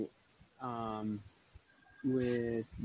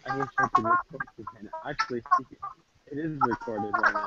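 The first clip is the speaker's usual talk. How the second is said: high squeaky chipmunk sound; fast, squeaky sped-up voice; helium voice; faster than usual. Real, not acted. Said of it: "Yeah, [name], you sound funny".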